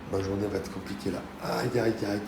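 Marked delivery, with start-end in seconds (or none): 1.4-2.2 talking through a sore throat; each word hurts the throat. Real, not acted. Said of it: "j'ai mal à la gorge".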